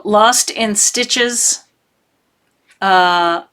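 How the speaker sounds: ordinary delivery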